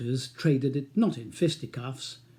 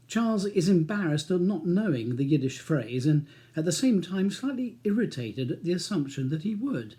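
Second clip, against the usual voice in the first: usual speaking voice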